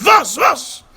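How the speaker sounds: high, loud alarmed cry; startled grandfather asking what happened. Retold or imitated. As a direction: rush in worried, calling out.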